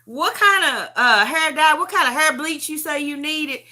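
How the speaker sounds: high, loud imitation of a daughter; daughter's voice. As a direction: mimic your daughter pointedly questioning mama. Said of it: "then she'd be like".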